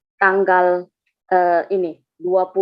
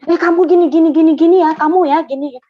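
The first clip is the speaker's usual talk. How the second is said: loud, higher-pitched finger-pointing at someone; meniru omelan sendiri; 6 semitones higher, 6 dB louder, faster than usual. Retold or imitated. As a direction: mimic your old telling-off, raised and loud. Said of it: "dulu saya suka gini". (menirukan diri sendiri menuding orang dengan suara keras)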